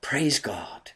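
whispered praise. Real, not acted. Whispered aside in sermon.

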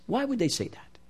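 usual speaking voice

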